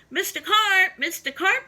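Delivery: high, loud calling out; yelled name. Acted. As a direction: call into the pool. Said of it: "I yelled".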